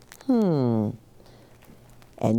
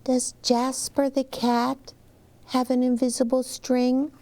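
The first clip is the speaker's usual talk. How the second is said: lightly voiced little girl; 8 semitones higher than usual. Child Liza voiced.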